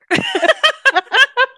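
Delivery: long, high peal of giggles; high cackling laugh. Real, not acted. Cracking up at her own fox pun, high loud laugh.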